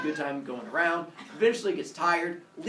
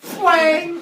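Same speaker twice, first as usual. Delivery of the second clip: high, loud imitated twang; explosive blow with a stringed instrument; cartoonish ringing whack; guitar smash noise; 12 semitones higher, 14 dB louder than usual. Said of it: "just goes".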